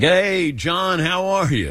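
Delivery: high, slow, hearty hello from a big man; big friendly greeting. Retold or imitated. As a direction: greet an old teammate with open arms, drawn out. Patrick greeting John warmly.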